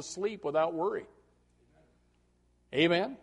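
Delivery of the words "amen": ordinary talk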